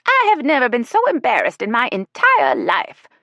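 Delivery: affronted grandma, high and loud; Ella voiced. A narrator's reading. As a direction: squeak it out, shocked and wounded. Ella, mortified at lunch.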